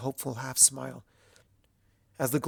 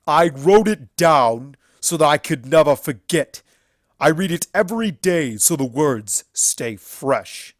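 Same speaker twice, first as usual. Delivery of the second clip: much louder stallion voice; proud declaration; 4 semitones higher, 12 dB louder than usual. A narrator's reading. Everfree answering Luna, loud and proud. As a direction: stand tall and declare it. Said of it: "drew himself up to his full height".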